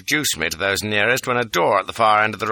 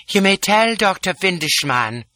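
lightly voiced imperious woman, higher; a hint of haughty royal scorn; contemptuous Lola; 9 semitones higher than usual. A narrator's reading. Lola scolding the chap, mild contempt. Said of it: "husky voice charged with contempt".

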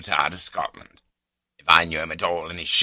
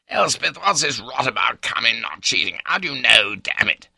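raised, impatient husband's voice; exasperated demand; 6 semitones higher, 5 dB louder than usual. A narrator's reading. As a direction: press the wife hard, voice pitched up.

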